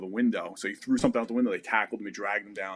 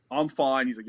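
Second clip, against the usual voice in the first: ordinary delivery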